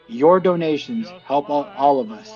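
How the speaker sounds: normal talking voice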